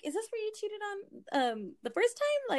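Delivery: high, sarcastic question; snide, high mock question. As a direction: needle him with it. Mocking the cheater.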